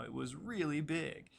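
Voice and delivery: REALLY drawn out with a pitch jump; stress on REALLY. Shown as a demonstration. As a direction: lean hard and slowly on the word REALLY. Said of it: "emphasize the word really".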